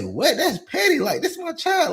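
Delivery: high, indignant disbelief. Retold or imitated. Father retelling his retort to the mother, indignant. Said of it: "I say".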